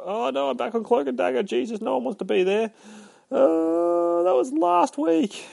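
high, squeaky mock panic; mock dismay. Comic reviewer lands back on Cloak and Dagger, high mock dismay. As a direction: put on a high whine of exaggerated horror.

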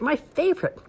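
put-on high, gleeful kid voice; delighted boy. Ian holding his chocolate bunny, delighted. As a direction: savor the prize happily. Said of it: "exclaimed Ian".

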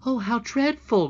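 loud, high cry of dismay; a shuddering princess, crying out; horrified cry. A narrator's reading. Princess hearing the cobs' plot, horrified cry. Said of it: "cried the princess, shuddering".

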